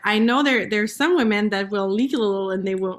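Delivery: normal talking voice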